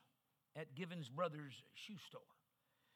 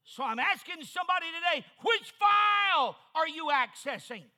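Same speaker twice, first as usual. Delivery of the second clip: shouted preacher's challenge, much higher and louder; preaching at full force; 10 semitones higher, 18 dB louder than usual. Real, not acted. Preacher challenging the congregation, full-force shout. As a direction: call it out to the whole congregation. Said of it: "I'm preaching to somebody right now".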